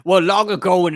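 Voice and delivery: loud, grand fake backstory opening; mock dramatic storytelling. Player jokingly starts a backstory for the group, mock dramatic. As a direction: launch loudly into a tall tale.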